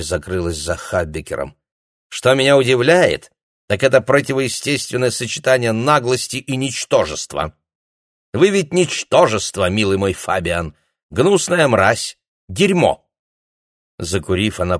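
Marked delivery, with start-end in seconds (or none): 2.1-13.1 high, loud sneering contempt; contemptuous. Acted. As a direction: spit out the insults. Gerti insults the prisoner. (высокое громкое презрение)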